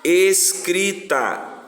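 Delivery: very slow, stretched-out syllables. Shown as a demonstration. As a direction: draw out each syllable for the class. Teacher dictates word syllable by syllable. (sílaba por sílaba, bem devagar)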